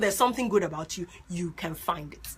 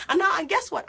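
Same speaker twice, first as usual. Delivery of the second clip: ordinary talk, nothing marked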